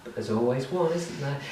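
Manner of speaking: ordinary delivery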